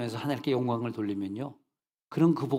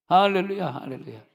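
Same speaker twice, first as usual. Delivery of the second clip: louder call of 할렐루야 falling at the end; preacher's joyful hallelujah shout; loud hallelujah cry; 5 semitones higher, 3 dB louder than usual. Real, not acted. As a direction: shout it out to the congregation.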